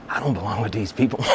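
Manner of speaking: winded, re-enacted struggle for breath; acted breathlessness. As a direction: say it as if breathing hard. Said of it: "I'm breathing hard at the time".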